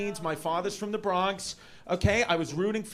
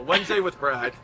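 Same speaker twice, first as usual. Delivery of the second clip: lower, title-card announcer voice; announcer title read; 4 semitones lower than usual. Shown as a demonstration. Comic naming his next special in announcer voice. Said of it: "should be the name of my next special".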